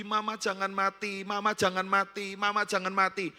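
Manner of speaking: put-on high, insistent repeated child's wail; imitated crying plea. Daughter wakes crying beside sick mother, imitated high plea. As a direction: imitate a daughter begging through tears. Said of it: "Melek nangis lagi".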